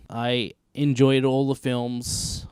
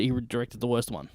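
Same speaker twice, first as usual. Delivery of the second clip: usual speaking voice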